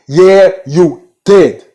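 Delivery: YEAH, YOU, DID each stretched slow and loud; drawn-out accusation. Retold or imitated. Accusing Charity after she messed up, emphatic mock accusation. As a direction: punch "Yeah, you did" word by word, slowly.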